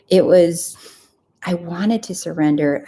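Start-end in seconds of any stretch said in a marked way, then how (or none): none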